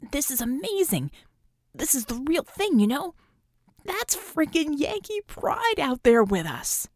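put-on high, gushing thrill of a young fan; giddy excitement. Young hero gushes to teammates, giddy and high. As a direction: bubble over with excitement. Acrobat gushing after a win.

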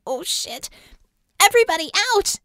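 high-pitched alarm, building to a shout; panic, then yell to clear the room; frantic shouted order; urgent warning yell. A narrator's reading. Sudden danger, warning the team.

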